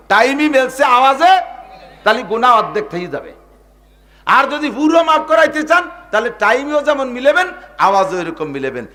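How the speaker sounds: high, loud, impassioned sermon voice; fiery preaching. Acted. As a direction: thunder it out from the pulpit. Preaching at full force. (জোরালো ওয়াজের সুর)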